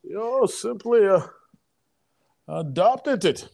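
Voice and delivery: put-on slow, odd Bane accent parody; bad Bane impression. Cohost riffing on Bane's line, bad Bane accent. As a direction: play a clumsy Bane spoof. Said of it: "I'm doing Bane impressions, bad ones at that".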